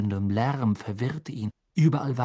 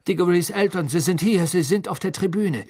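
raised, high, urgent voice; agitated; 5 semitones higher, 4 dB louder than usual. A narrator's reading. Fudge warning that Diggory's parents are here, agitated. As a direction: press it urgently, louder and higher.

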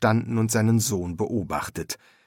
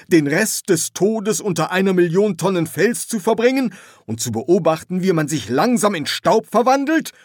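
high, raised voice climbing in protest; indignant rant; 9 semitones higher, 5 dB louder than usual. Acted. Ignored ghost protesting against burial, indignant rant. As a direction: rant in outraged questions, high and loud. Pharaoh rants at being ignored.

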